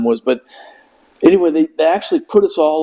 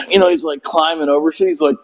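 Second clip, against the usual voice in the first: ordinary talk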